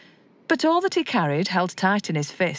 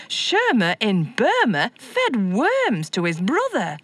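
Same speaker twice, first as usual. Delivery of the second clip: ordinary delivery, nothing marked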